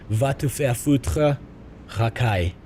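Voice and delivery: "Va te faire foutre, racaille" slowly, in careful French; "Va te faire foutre, racaille" as a slow language demo; practised French pronunciation. Shown as a demonstration. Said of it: "getting the pronunciation of that right".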